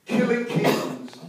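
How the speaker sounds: high, slow, drawn-out sermon title; title announcement. Real, not acted. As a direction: proclaim the title, stretching each word.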